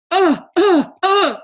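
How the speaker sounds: high, loud flustered uh sounds; real stammering with a caught-out fluster; loud, high-pitched embarrassed uhs. Real, not acted. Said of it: "Okay, that was me".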